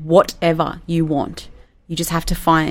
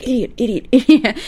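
self-scolding; 6 semitones higher than usual. Retold or imitated. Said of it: "they're gonna be like".

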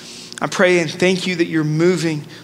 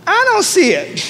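high, playful denial; mock indignation; 13 semitones higher, 3 dB louder than usual. Real, not acted. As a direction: protest the nickname with a grin. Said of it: "just to be real".

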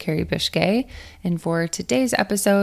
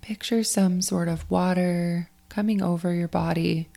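guided meditation; 4 dB quieter than usual. Acted. Coach guides athletes to let go of last week, in a guided meditation voice. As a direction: ease listeners through the breathing, unhurried and spacious.

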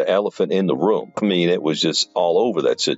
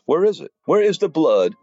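mocking demand; 11 semitones higher than usual. Demanding challenge to fake Bibles.

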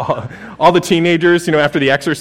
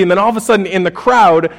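ordinary talk

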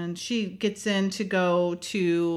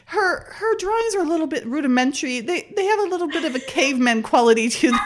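fast and higher, laughing through the quote; 6 semitones higher, 5 dB louder, faster than usual. Retold or imitated. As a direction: quote the colleague while cracking up. Quoting colleague, laughing throughout.

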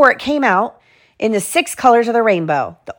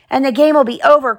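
higher, loud and anxious; worried cry; 6 semitones higher, 3 dB louder than usual. A narrator's reading. Tim adding his fear to the class outcry. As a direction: finish the warning in a rush. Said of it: "cried Tim".

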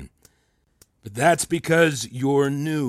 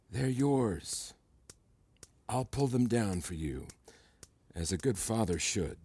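soft fatherly menace; 3 semitones lower, 11 dB quieter than usual. Acted. Mentor makes a creepy paternal promise.

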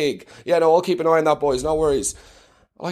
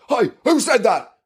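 soldier calling out; 6 semitones higher than usual. Pretend. Soldier hearing hidden voice.

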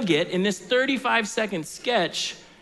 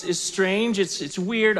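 ordinary talk, nothing marked

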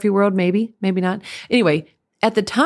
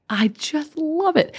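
higher, slow, gushing "love"; 9 semitones higher, slower than usual. Real, not acted. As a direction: draw out "love" with delight. Gushing about loving composition.

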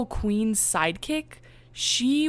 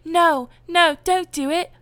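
high, louder princess plea; imitating Aurora begging; 10 semitones higher, 5 dB louder than usual. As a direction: beg in a high, girlish princess voice. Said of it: "Aurora was like".

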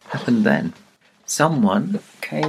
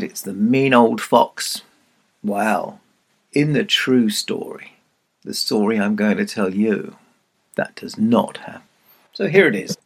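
usual speaking voice

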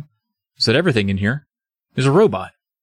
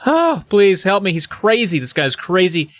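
acted plea; 7 semitones higher than usual. Jerry pleading to his rescuer.

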